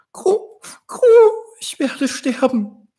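very high, weepy dying dove; crying dove. Acted. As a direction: sob it out in a high bird voice. Dove fears death, crying.

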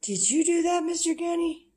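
high playful fake scolding; mock scolding. Real, not acted. Teasing the dog about the mess, high and playful. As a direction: tease him, way up high.

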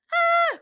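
high startled ah; frightened cry. Acted. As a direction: gasp out a fright. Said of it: "cried Oogle Doogle".

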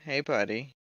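loud friendly call; called greeting. Acted. Player calls out to the figure in the dark, loud. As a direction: call out to him across the room. Calling out to a game character.

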